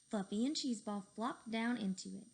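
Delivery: ordinary delivery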